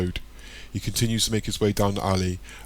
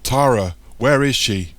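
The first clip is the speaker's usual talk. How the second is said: loud, raised, stern demand; 4 semitones higher, 7 dB louder, slower than usual. A narrator's reading. Michael demanding Tara's whereabouts, stern and loud. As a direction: demand an answer forcefully. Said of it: "says sternly".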